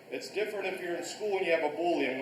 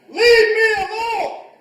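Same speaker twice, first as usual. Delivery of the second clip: loud demonstration shout; 16 dB louder than usual. Shown as a demonstration. Karate teacher showing how to stop a bully, loud shout. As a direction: shout it so the whole room hears. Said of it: "why do I say this loud".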